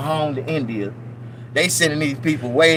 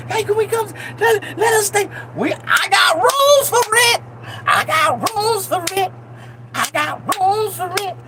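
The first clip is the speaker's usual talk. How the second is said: begging immigrant; 14 semitones higher than usual. Pretend. Desperate immigrant begging to stay, high crying plea. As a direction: beg in a high tearful voice.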